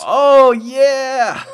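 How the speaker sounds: high, loud happy shout; excited cheer. Real, not acted. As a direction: cheer for the win. Friend's Pokemon evolved.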